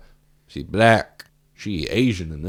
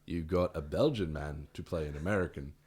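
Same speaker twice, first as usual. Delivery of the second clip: usual speaking voice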